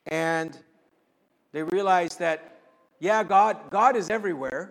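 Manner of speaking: rising into loud, impassioned preaching; preacher to congregation, swelling from measured to loud; building preaching. Real, not acted. Preacher to congregation, swelling into loud fervent proclamation.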